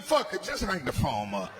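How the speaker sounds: ordinary talk, nothing marked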